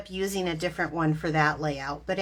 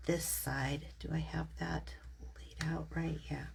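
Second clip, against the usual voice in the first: whispered to herself; whispering; 11 dB quieter than usual. Real, not acted. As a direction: whisper it while you concentrate. Muttering to herself while planning.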